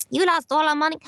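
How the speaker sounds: warming into the impression; getting closer to Rosie Perez. Shown as a demonstration. Practising the line again while still searching for her voice, partway there. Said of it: "at first it was like".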